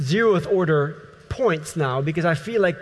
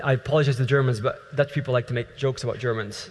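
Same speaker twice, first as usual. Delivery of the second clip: ordinary delivery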